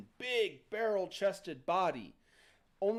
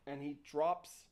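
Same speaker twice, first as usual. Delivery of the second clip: normal talking voice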